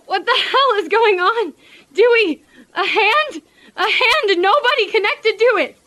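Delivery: high-pitched, rising panic; alarmed disbelief. Acted. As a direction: blurt it out in horrified bewilderment. Sees a severed moving hand.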